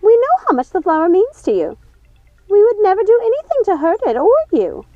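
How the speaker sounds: high eager pleading voice; Ruby showing Gothel the flower; frantic sister. A narrator's reading. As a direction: show it off high and breathless. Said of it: "Ruby pleading".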